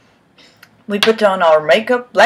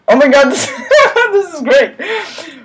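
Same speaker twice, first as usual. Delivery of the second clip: laughing; 10 semitones higher than usual. Real, not acted. Reader cracks up at the text.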